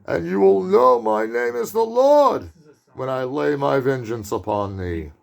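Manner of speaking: slow, high-pitched hammed-up Brooklyn accent; John Cassavetes Brooklyn accent. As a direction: thunder out a Bible verse in a Brooklyn accent. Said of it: "That was my, kind of my John Cassavetes Brooklyn accent".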